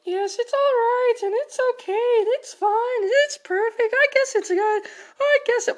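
high, chirpy, rambling mom; high mommy voice. Acted.

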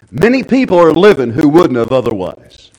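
"Many people are living" belted out high and loud; loud preaching. Shown as a demonstration. Loud build after quiet line.

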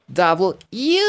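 "W" ending in a high "eww"; mock yuck on "W"; double disgust. Shown as a demonstration. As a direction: stretch the end of "W" as if grossed out. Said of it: "como si les diera doble asco".

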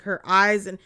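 ordinary delivery

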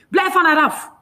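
high, loud command; outraged, full-voiced order; furious shout. A narrator's reading. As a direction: yell a warning to back off. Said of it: "roept Jamie".

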